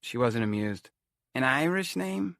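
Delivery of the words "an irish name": high, slow Romany fortune-teller; drawn-out, high-pitched Romany-accented query. A narrator's reading. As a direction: question it in a thick Romany accent. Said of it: "she talked in a thick Romany accent".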